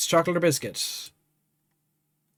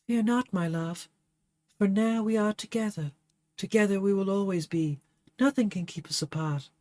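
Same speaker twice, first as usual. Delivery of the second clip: soft, higher voice slipping away; fading dying words; 4 semitones higher, 4 dB quieter than usual. Acted. Dying Favia comforting Burnett, soft and fading. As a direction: let her life ebb out gently.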